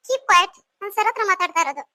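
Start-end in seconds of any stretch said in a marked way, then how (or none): 0.1-0.6 loud, sharp order; sharp silencing. Acted. Lecturer silencing student mid-protest, sharply. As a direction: cut the student off loudly.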